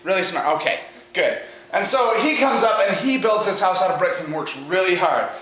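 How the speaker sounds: plain reading voice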